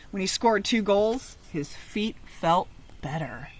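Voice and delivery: ordinary delivery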